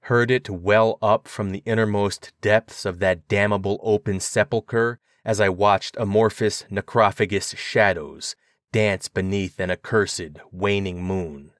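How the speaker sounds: usual speaking voice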